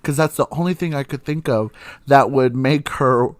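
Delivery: ordinary delivery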